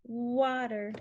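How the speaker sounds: stretched rounded W starting "water"; W sound. Shown as a demonstration. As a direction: hold the W glide in "water". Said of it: "The W, you move your lips".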